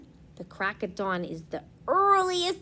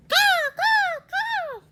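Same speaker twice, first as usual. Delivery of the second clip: high, loud cawing crows; crow caws; 5 dB louder, slower than usual. Acted. Crows fleeing the farmer, cawing high and loud. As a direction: caw like startled crows flying off.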